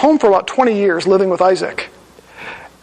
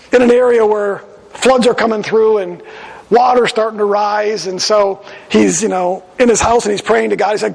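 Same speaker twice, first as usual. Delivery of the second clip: ordinary talk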